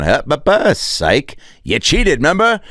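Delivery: sing-song mocking gotcha; mock taunt. Commissioner taunting the Astros, mock sing-song gotcha. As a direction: tease them like a prankster. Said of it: "and then gone".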